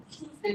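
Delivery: "Tuesday" said slowly with a ch onset; careful, slow "Tuesday"; model pronunciation. Shown as a demonstration. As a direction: teach "Tuesday" as Chewsday. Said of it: "not Tuesday".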